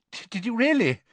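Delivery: high, stammering, caught out; nervous. Acted. Mr Weasley nervously covering for wizards, stammering. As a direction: stumble on the first word. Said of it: "said Mister Weasley nervously".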